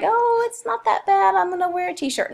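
a carefree student mocked by her teacher; mock careless girl. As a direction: play a breezy teen who won't dress warm.

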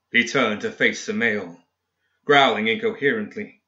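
ordinary delivery

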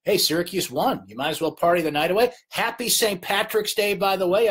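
ordinary talk

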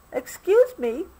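high, polite little voice; high polite ask. A narrator's reading. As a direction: politely get attention. Obie asks politely.

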